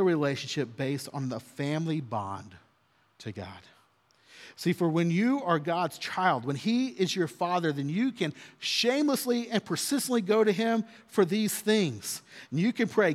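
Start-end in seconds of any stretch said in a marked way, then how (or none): none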